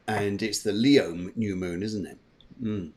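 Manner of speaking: usual speaking voice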